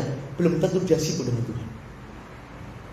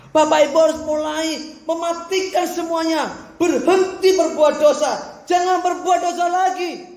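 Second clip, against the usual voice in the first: slow, deliberate, higher exhortation; solemn slow preaching; 8 semitones higher, slower than usual. Acted. Preacher urging the congregation to stop sinning, slow and emphatic. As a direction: press every word slowly on the hearers.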